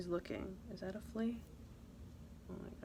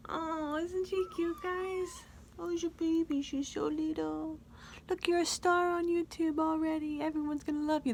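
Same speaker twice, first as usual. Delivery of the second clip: put-on cooing baby talk; 8 semitones higher than usual.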